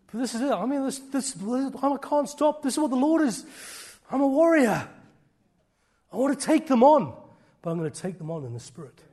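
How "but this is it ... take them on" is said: building from raised to much higher, louder shout; preaching at full force, climbing; rising warrior shout. Real, not acted. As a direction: roar it like a warrior ready for battle.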